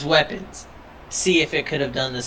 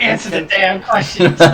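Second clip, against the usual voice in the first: mock angry demand; 3 semitones higher, 4 dB louder than usual.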